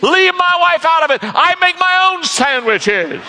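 high, loud indignant retort; indignant snap. Retold or imitated. Worker snapping at his coworker to leave his wife out, high and loud. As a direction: bite back, offended.

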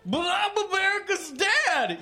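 very high, whiny cartoon protest; hammed-up high-pitched yell; yelling Bill Cosby. A narrator's reading. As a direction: yelp it in injured mock outrage. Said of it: "yelled the fuck trash".

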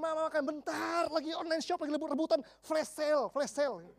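a mom busy with a flash sale; mock distracted mom. Shopping-obsessed mother brushing off child.

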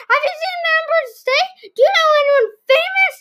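high-pitched eager gushing; over-eager, squeaky fan questioning; overexcited kid. A narrator's reading. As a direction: fire off questions with breathless enthusiasm. Overenthusiastic Max fires off questions.

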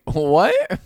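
high, loud "what?" of disbelief; shocked reaction. Retold or imitated. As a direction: blurt it out, voice jumping up. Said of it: "I was like".

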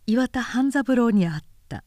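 ordinary delivery, nothing marked

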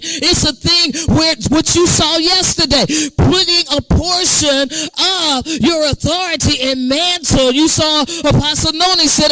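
loud, driving sermon delivery; full-force preaching. Real, not acted. Preacher telling the church what Elisha received, preaching hard. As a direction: preach it with rising urgency.

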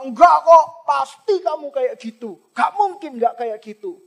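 mildly stubborn priest brushing her off; stubborn accusation. Pretend. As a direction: refuse to hear her out. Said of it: "Eli ... berkata".

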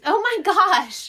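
loud, high, drawn-out astonishment; real amazed exclamation. Real, not acted. Friend hearing that mom guessed instantly, real amazement. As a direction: cry out slowly in disbelief.

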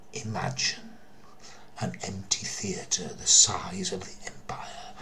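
hushed, breathy whisper drawing listeners in; spooky whisper. Acted. Whispering to listeners as the ghost story begins. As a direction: whisper it like setting a ghost scene.

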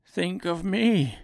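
high, slow self-pitying plea; pleading little man. A narrator's reading. Oz fearing being found out, slow plea.

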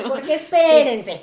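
laughing, slow protest. Real, not acted.

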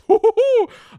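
very high, loud character whoop; piercing falsetto mascot call; cartoon hen noise. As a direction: hoot like a squeaky cartoon bird. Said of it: "that's his, the noise".

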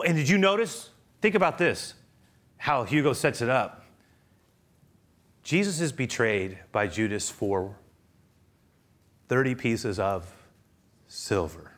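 ordinary delivery

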